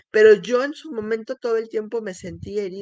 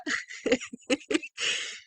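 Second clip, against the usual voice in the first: breathy pained laugh; 3 semitones higher, 6 dB quieter than usual. Real, not acted. Laughing at own hurt.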